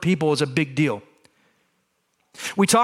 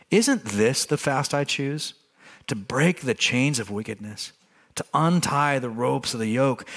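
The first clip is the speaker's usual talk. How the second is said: scripture reading; 4 semitones lower than usual. Acted. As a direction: read the passage calmly and deliberately.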